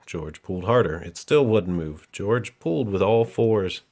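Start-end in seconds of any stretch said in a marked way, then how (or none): none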